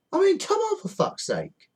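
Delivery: outraged. Real, not acted. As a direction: throw up your hands in outrage. Ranting at panic buyers.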